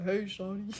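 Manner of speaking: playful, badly faked man's pickup call; fake guy voice. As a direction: put on an unconvincing guy voice. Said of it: "when I fake my guy voice".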